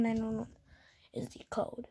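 hushed whisper. Acted. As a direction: whisper the code like a secret. Secret code said quietly.

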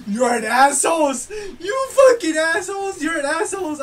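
loud furious shout at opponents; angry yell. Real, not acted. Gamer raging at players who double team her, furious shout. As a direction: scream it in real frustration.

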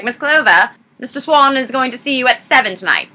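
imitated henchman on the telephone; put-on henchman voice, delivering a message; Roddy McDowell. Henchman phoning Miss Clover with a message, put-on voice. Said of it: "That's my best Roddy McDowell impression".

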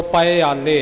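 ਪਇਆਲੇ said as pa-i-aa-le, each syllable apart; separate syllables. Shown as a demonstration. Teacher demonstrating ਪਇਆਲੇ to students, syllables pulled apart. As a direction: spell out ਪਇਆਲੇ slowly with the i sound clear. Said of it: "ਈੜੀ ਨੂੰ ਸਿਹਾਰੀ ਲਾ ਕੇ ਇਹ ਪਾਠ ਕਰਨਾ ਹੈ".

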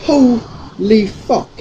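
jaw-dropped disbelief at the storm; stunned shock. Real, not acted. Streamer showing viewers the storm outside, shrieking in shock.

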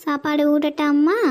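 usual speaking voice

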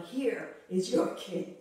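slow, emcee-style presentation; showman's announcement. Retold or imitated. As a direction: present him like a ringmaster, stretching here. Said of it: "Ladies and gentlemen".

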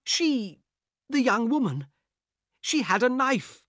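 high, loud stammering disbelief; bewildered protest. Acted. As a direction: insist desperately it happened. Harris baffled by empty carriage.

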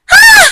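very high, loud outraged "Ah!"; outraged cry. Acted. Mother to daughter, a shrill outburst of outrage. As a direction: let out a sharp cry of outrage.

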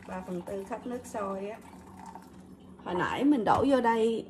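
ordinary talk

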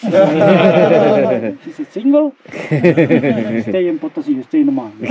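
laughing while teasing; slow, giggling tease. Real, not acted. Laughing joke to miner.

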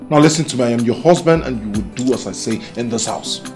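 low, controlled command; firm, low-pitched husbandly order; stern authority. Acted. Husband lays down law after shout.

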